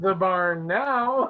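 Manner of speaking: ordinary delivery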